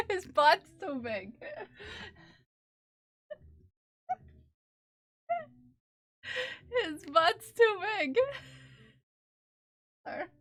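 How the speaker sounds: giggling at the chunky cat; laughing. Real, not acted. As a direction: crack up while saying it. Laughing at cat's pose.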